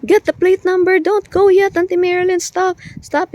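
high, loud frantic yelling, falling back near the end; frantic kids yelling at their auntie to stop; panicked shouting. Retold or imitated. Nephews shouting at their aunt after the crash, panicked high yell. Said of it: "tarantang-taranta na talaga".

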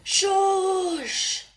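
high, loud, long-held "shush". Acted. Someone cutting off the complaining koala, loud drawn-out shush. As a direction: silence them with a stretched, high shush.

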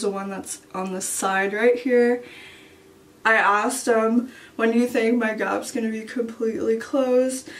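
talking with lip pulled down; showing teeth while speaking; finger in mouth. Real, not acted. Seen 1: Finger pulls lower lip down to show teeth while speaking.

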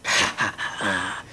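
low sinister chuckle; evil demonic laugh. Acted. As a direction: cackle like a haunting demon. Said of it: "Fucking demonic voice".

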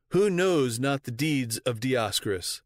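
ordinary delivery

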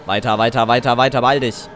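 loud urgent urging; hurry, something's coming; being chased. Real, not acted. Rushing through game danger.

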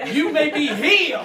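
loud, high preacher cry; faith healer shout. Acted. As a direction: lay hands and heal them. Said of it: "I grew up in that kind of church".

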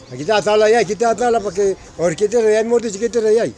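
loud, high calling out; shouting. Real, not acted. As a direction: bark orders to move aside. Ordering someone out of photo.

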